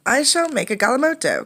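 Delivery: put-on high, loud boyish boast; eager boast. Condi boasting to his brother, high and loud. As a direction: announce it proudly like a small boy.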